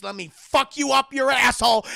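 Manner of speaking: loud, high acted threat; menacing shout. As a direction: explode at him in mock rage. Son's threat at its peak.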